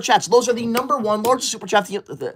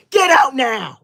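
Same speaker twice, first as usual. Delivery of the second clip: raised, high-pitched shout; angry order; 4 semitones higher, slower than usual. Acted. Streamer banishing rude viewer, angry order. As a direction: throw them out indignantly.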